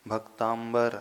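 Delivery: भक्तांबर with an mb sound replacing the m; mispronounced भक्तांबर. Shown as a demonstration. Teacher showing students the altered syllable, mispronounced भक्तांबर with "mbar". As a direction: say भक्तांबर, a nasal then a clear "bar". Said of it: "अक्षर बदल दिया आपने".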